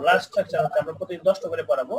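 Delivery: usual speaking voice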